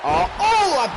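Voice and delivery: high startled oh oh; shocked gasp. Real, not acted. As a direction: react like you can't believe it. Shocked reaction to big move.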